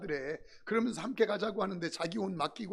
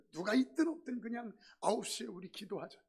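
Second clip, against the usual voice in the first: ordinary delivery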